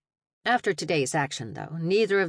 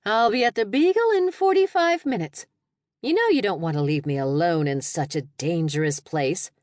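teasing friend; 3 semitones higher, 4 dB louder than usual. Acted. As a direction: tease her from a noisy car. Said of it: "her friend teased".

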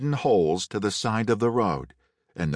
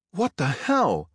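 startled higher shout; 7 semitones higher than usual. A narrator's reading. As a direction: blurt it out in alarm. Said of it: "the driver shouted".

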